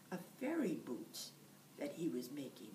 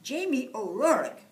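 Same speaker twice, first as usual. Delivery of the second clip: loud, higher call of his full name; wife calling her husband; 7 semitones higher, 14 dB louder than usual. Acted. Wife calls Jamie by full name, loud and nagging. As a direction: summon the lazy husband by name. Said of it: "his wife Eileen would say".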